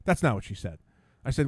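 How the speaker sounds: ordinary delivery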